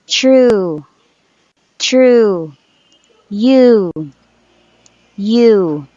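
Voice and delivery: true and you with a held oo; long oo examples; long U words. Shown as a demonstration. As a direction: draw out the oo. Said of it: "this is the long U".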